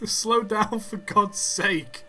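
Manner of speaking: high laughing yell; laughing shout. Real, not acted. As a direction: yell it, laughing, slowing at the end. Train crash in game.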